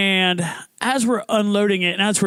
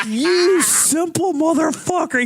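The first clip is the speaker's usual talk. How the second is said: high, slow gleeful mockery; imitating a friend crowing over your mistake; giddy gloating friend; 9 semitones higher, slower than usual. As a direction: savor the insult with delight. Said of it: "the giddiness and joy in his voice".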